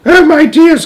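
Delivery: shrill, very high wail of fright; cried. A narrator's reading. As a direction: wail in terror to the family. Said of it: "cried Grandma Georgina".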